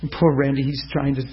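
usual speaking voice

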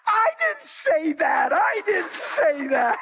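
high, laughing squeal of denial; mock the giggling girl's protest; high giggling cry.

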